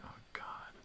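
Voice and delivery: under-breath embarrassed whisper; whispered oh God. Retold or imitated. The wife mortified by his yelling, whispered. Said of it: "My wife is like".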